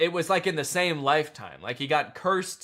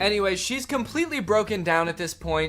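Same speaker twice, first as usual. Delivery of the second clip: ordinary talk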